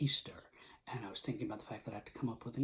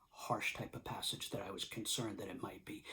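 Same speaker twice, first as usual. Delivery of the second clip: ordinary talk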